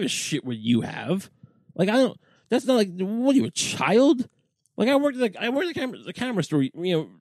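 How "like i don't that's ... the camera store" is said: exasperated rant. Real, not acted. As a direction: vent the outrage about the salesman. Ranting about pushy salesman.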